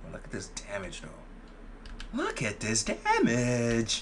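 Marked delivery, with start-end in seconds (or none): 2.1-4.0 very slow, stretched-out words; drawn-out exclamation. Real, not acted. Streamer showing off the damage, slow drawn-out exclamation. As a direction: drag out every word for effect. Repeats the remark for effect.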